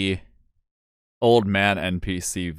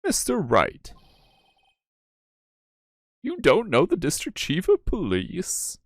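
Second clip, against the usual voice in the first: high, swooping voice of disbelief; incredulous explainer, pitched well up; astonished at the listener's ignorance, high and animated; astonished game character; 5 semitones higher than usual. Acted.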